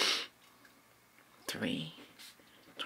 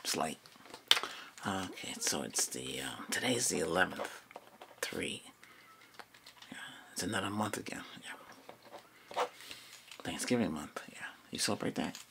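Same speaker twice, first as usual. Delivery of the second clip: whispering; 7 semitones lower, 6 dB quieter than usual. Acted. ASMR roleplay whispering.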